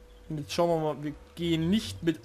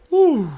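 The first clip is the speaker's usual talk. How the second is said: relieved groan; 11 semitones higher, 12 dB louder than usual. Real, not acted. Relief after scare.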